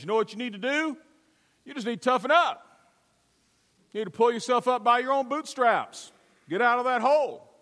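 a preacher playing a brash tough guy. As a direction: bark tough love down into the hole. Tough guy lecturing man in hole.

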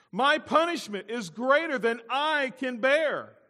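high, slow, loud protest; loud complaint. Acted. Cain protests his punishment to God, loud complaint. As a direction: stretch out the self-pity at full voice. Acts out Cain's selfish complaint.

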